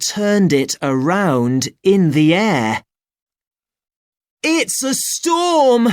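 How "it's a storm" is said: put-on high excited boy's shout. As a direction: cry out the warning as a boy. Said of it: "shouted Ben".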